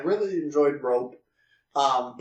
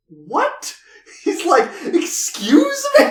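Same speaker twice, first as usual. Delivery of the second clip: much higher, louder, slow squeak of indignation; mock offended; 14 semitones higher, 6 dB louder, slower than usual. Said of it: "the weirdest face".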